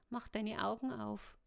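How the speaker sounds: ordinary delivery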